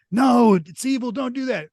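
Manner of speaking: high, loud yell from the crowd; retold heckler's high-pitched shout; imitated yelling. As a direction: cry out a warning, pitch way up. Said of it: "yelling, like".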